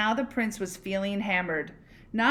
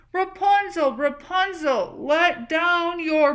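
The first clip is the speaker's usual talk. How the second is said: last desperate call; 8 semitones higher, 7 dB louder than usual. Acted. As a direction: stretch out one last call up the tower.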